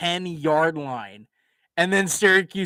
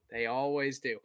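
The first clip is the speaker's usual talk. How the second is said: "They always do" repeated softer, almost to himself; resigned repeat; 8 dB quieter than usual. Real, not acted. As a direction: mutter it again under your breath, resigned. Quieter muttered repeat.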